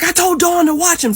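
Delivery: high, loud protest; agitated retort. Retold or imitated. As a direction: imitate an exasperated father's outburst. Father's agitated retort, retold.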